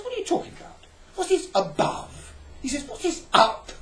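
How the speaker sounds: the flat man thinks you're a crank; high, loud bewildered protest; indignant flatlander. Pretend. Flatlander can't grasp "above.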